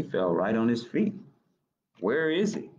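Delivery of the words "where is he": ordinary delivery, nothing marked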